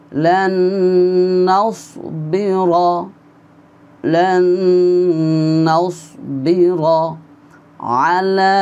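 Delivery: وإذ قلتم يا موسى drawn out slowly; slow recitation. Shown as a demonstration. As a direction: recite each word long and clear. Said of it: "আমার সাথে সাথে আপনিও উচ্চারণ করে পড়বেন".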